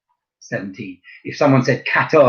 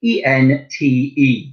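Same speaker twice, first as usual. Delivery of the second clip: slow spelling out; much slower than usual. Shown as a demonstration. Teacher spelling trente slowly for pupils. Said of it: "spelled slightly differently".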